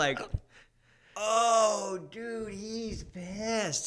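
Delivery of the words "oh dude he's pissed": high, long drawn-out awed reaction; hammed-up alarm. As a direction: stretch it out like a stunned kid watching. Retells reaction to dad's donut throw.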